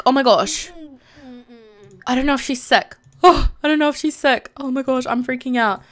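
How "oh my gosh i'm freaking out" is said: ordinary talk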